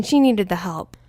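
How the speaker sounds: ordinary delivery, nothing marked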